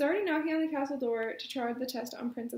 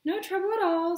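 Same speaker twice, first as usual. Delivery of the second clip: higher, louder eager reply; quick and eager; 6 semitones higher, 6 dB louder than usual. A narrator's reading. The prince eagerly welcoming the wet princess, higher and louder. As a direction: jump in eagerly to reassure her. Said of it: "said Prince Patrick quickly".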